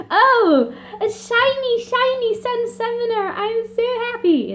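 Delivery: very high, squeaky, delighted; gushing king. Retold or imitated. The king gushing over the Sun Summoner, squeaky and high. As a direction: coo it like a thrilled, silly old king. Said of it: "He's like".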